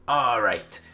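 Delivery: slow goblin voice rising to a loud demand; goblin interrogator. Acted. Goblin questions a tied-up barbarian, menacing creature voice. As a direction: draw it out, then raise pitch and volume. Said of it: "one of the goblins goes up to him and says".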